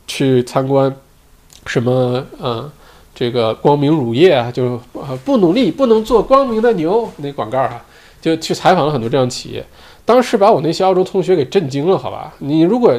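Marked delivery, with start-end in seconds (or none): 5.2-7.1 ad slogan voice. Retold or imitated. Quoting a dairy ad slogan.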